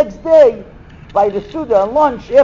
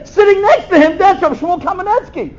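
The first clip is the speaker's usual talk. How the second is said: excited reveal; 9 semitones higher than usual. Retold or imitated. Hosts reveal the twist.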